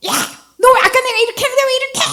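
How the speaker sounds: high, fast rattled-off market-auntie tirade; fishwife scolding. Retold or imitated. Preacher berating someone like a market auntie, high fast yell. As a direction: fire it off like a Busan fish seller. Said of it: "생선 파는 아줌마의 목소리더라고요".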